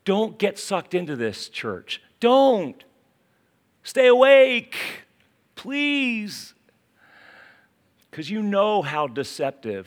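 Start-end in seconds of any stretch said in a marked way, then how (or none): 2.2-6.5 a pastor pleading with his flock; urgent plea. Acted. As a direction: beg them not to fall asleep. Preacher pleading with his church.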